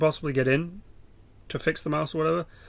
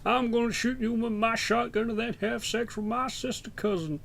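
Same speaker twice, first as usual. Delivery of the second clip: high-pitched hillbilly caricature; 6 semitones higher than usual. Retold or imitated. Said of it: "That's what Americans sound like to me".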